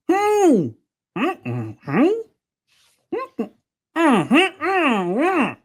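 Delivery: high closed-mouth humming trying to talk; muffled hums. Retold or imitated. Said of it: "but all you heard was".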